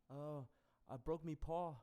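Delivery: lightly put-on Kiwi accent; quick New Zealand accent impression. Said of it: "that's like a Kiwi accent".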